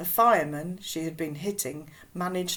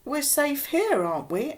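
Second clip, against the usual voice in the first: higher, louder anxious objection; protesting; 7 semitones higher, 5 dB louder than usual. Acted. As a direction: push back with a questioning protest. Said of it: "I protested".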